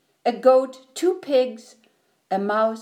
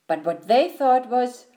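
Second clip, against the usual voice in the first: ordinary delivery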